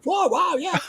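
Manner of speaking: starstruck wow. Retold or imitated. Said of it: "I said".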